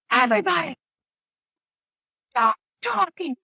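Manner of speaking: high-pitched imitation of a doctor silencing the room; mock yell. Doctor silencing a loud operating room.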